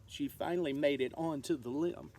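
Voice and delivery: ordinary delivery, nothing marked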